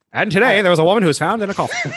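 fast, high announcer read; old newsreel. Shown as a demonstration. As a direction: rattle it off like a newsreel narrator. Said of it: "I want it to sound like an old newsreel".

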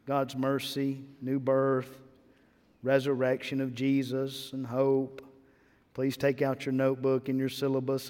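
droning through the lecture topics like a tired professor; bored college professor. Pretend. Preacher mocking a bored professor reading out the lesson, dull lecture drone. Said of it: "in a fashion of a bored and boring college professor".

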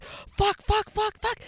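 high, loud panicked swearing; blurting curses in sudden alarm; panicked cursing. Retold or imitated. Waking to a dead phone during labor, panicked high cursing.

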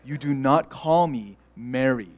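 low, slow, stern correction; a Korean mom setting the boy straight. Retold or imitated. Mom correcting son's friend: low, slow, stern.

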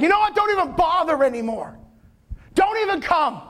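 high, cutting dismissal; a grieving father waving Jesus off; bitter rage. Acted. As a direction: shut him down in anger. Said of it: "Instant rage".

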